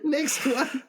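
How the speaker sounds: high, squeezed out through laughter; real laughing. Real, not acted. Joke teller announcing another joke, laughing hard. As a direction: call for the next while still cracking up.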